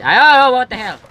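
high, loud burst of alarm; startled yelp. Real, not acted. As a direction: yelp as if something jumped out. Startled by something in the game.

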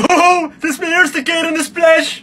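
thrilled shout on a ride; excited yelling. Acted. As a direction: squeal it like a first-time rider. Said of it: "Schreeuw niet zo".

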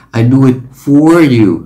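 slow, "for" said in full and stressed; a careful full "for"; unnatural full for. Shown as a demonstration. Teacher showing what people don't say: full stressed "for". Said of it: "người ta sẽ không nói là".

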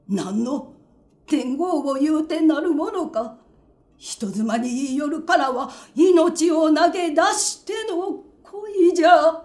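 loud, high impassioned declaration; impassioned vow. Acted. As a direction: swear your life on it, building loud. Tojuro vows his love.

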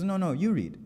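high-pitched mock protest; insistent refusal. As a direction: imitate a student stubbornly turning it back. Student insists again in retold exchange.

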